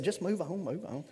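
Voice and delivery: soft, hushed dismissive quote of the wife; quiet aside. Retold or imitated. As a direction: murmur it away quietly, half-laughing. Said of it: "Teresa said".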